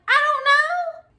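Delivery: high, loud, drawn-out protest; exaggerated helpless protest; exasperated retort. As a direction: stretch it out in a high mock whine. Acted retort to her dad.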